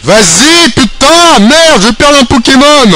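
high, loud cry of dismay; real frustrated outburst. Real, not acted. Player losing a Pokémon, real frustrated outburst. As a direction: wail in anger at the loss.

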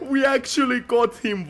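giggling through the words in relief; laughing disbelief. Real, not acted. Kill lands, laughing relief.

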